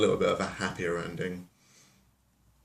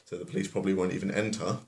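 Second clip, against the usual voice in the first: ordinary talk, nothing marked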